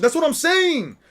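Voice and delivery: high, loud exclaim on saying; emphatic agreement. Real, not acted. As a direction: shout your agreement at the screen. Agreeing with the show.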